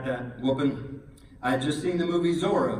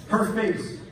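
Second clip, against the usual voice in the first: usual speaking voice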